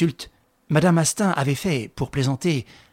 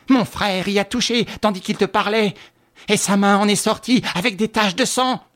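much higher, louder frantic tirade; overexcited accusation; 7 semitones higher, 5 dB louder than usual. A narrator's reading. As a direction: pile on the evidence, frenzied. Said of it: "surexcitée par les mots affreux".

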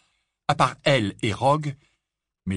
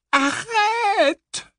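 shrill high scream; screaming stop; 7 dB louder than usual. Acted. Frightened sister shrieks at the magic, screaming. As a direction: scream it at the top of her lungs. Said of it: "s'égosilla Pétunia".

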